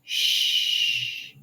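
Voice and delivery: Shh with tongue hovering mid-mouth; Shh, air around the tongue; sh sound. Shown as a demonstration. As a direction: blow a long Shh. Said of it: "It's just hovering in the middle of your mouth".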